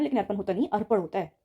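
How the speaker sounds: usual speaking voice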